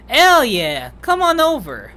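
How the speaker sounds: loud, high, excited invitation; whooping welcome, pitch jumping up; eager calling out. Acted. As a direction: call a friend over with gusto. Rai eagerly calls Kun over.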